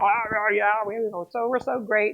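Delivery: lightly acted drunken boasting; mock drunk. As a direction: slur it like a rowdy drunk. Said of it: "all the Amalekites were drunk".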